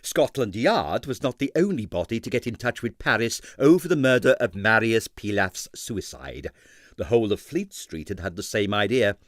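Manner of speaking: ordinary delivery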